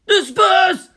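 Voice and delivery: high, loud barked commands; frantic orders. Acted. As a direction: shout the orders in panic. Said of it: "The tacnet rang with frantic orders".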